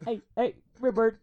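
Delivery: high coaxing call to a bird. Retold or imitated. Man calling to a bird.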